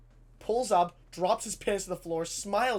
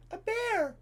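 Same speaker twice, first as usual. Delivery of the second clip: high, put-on teacher voice; 3 dB louder than usual. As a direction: announce it to the class in a high-pitched voice. Teacher answers in acted voice.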